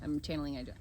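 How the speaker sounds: ordinary delivery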